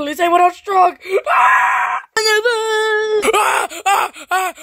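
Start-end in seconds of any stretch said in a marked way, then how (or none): 1.3-4.5 high crying shout; screaming in terror. Acted. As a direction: scream as they drag you off. Pirate caught, screaming.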